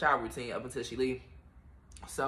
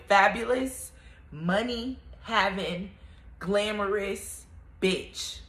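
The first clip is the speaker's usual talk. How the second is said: slow drawl; 4 semitones higher, much slower than usual. Acted. Drawn-out hype affirmation.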